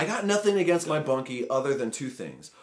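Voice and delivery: loud, high, emphatic complaint; ranting. Real, not acted. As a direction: lay out the grievance with comic exasperation. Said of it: "I need to go on a rant".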